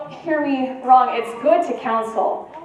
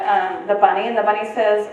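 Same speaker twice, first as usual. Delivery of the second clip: ordinary talk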